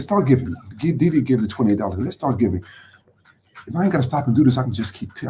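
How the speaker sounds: ordinary talk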